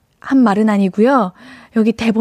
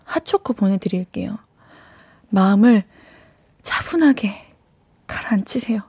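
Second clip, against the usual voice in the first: soft, lower calming voice; soothing; 4 semitones lower, 4 dB quieter than usual. Real, not acted. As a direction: gently settle an upset listener. Soothing an upset listener. (차분히 달래듯 나직하게)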